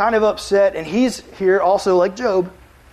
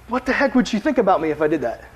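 normal talking voice

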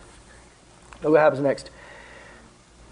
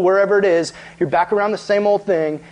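ordinary delivery

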